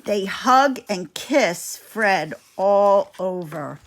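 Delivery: ordinary delivery, nothing marked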